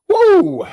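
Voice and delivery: high woo from spicy burn; pained high woo. Real, not acted. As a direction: let out a burning woo. Exclaiming from spicy heat.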